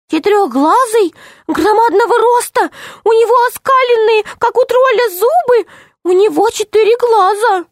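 high, fearful little gnome voice; frightened gnome. Acted. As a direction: tell it like a scared tiny gnome. Gnome describes the monster. (испуганный голос гнома)

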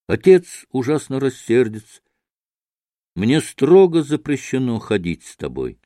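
ordinary delivery, nothing marked

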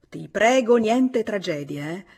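ordinary delivery, nothing marked

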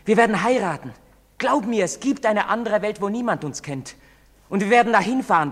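high, insistent excitement; urgent promise. Acted. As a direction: press her to believe it. Urgent pleading promise to lover.

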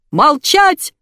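loud, very high squeal; shrieked command. A narrator's reading. Furious boss silencing wife, shrieking. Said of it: "взвизгнул Илья с такой силой".

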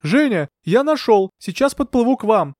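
high, hurried announcement from afar; calling across water. A narrator's reading. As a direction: shout that help is coming. Man calls across the water.

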